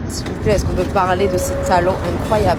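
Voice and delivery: loud, gushing excitement; excited. Real, not acted. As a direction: rave about them again, loudly. Repeats the gushing line.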